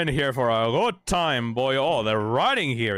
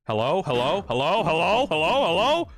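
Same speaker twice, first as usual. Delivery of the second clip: hello called out, climbing higher and louder each time; escalating hellos; building calls; 6 semitones higher, 6 dB louder than usual. Acted. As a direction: call out, rising every time. Calling out, rising each time.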